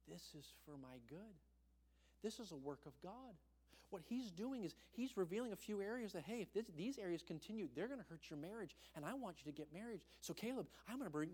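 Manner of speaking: ordinary talk, nothing marked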